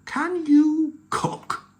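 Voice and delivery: very slow, high question; curious pirate chief. Acted. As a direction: ask it with dawning hope.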